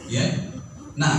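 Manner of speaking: ordinary talk, nothing marked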